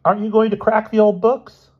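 high, loud schoolboy question; eager curiosity. A narrator's reading. Boy prodding his roommate about studying, high and curious. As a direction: ask it brightly and insistently. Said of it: "bursting with curiosity".

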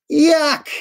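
high, loud squeal of disgust; loud disgusted yuck. Acted. Reader squeals at the gross gift for the kids, loud mock disgust. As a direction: shriek it like it's gross.

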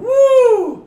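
loud high woo; excited whoop. Real, not acted. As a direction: let out a whoop.

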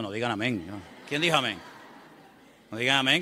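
ordinary talk

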